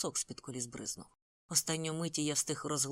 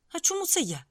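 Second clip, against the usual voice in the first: sudden high indignant outburst, louder; flaring up; 13 semitones higher, 7 dB louder than usual. A narrator's reading. Andrew flaring up at his boss, indignant and loud. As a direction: flare up in protest at being left out. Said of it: "спалахнув Ендрю".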